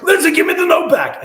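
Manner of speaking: high, loud, sharp demand; demanding yell. Retold or imitated. To Lindsay hiding the note, a loud demand. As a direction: bark it like a final warning.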